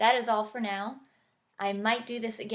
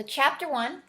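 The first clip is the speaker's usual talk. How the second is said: gentle storybook read-aloud; reading to children. Acted. Author reading her picture book to listeners, gentle storybook voice. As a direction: read it like bedtime story time.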